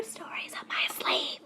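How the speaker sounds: whispered teaser. Real, not acted. Vlogger whispers a teaser to viewers. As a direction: stay tuned, whispered. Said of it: "whispered sign-off".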